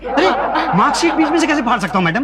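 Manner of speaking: high-pitched, incredulous objection; alarmed protest. Acted. Applicant told to tear his marksheet, alarmed. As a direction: jump up in pitch, aghast.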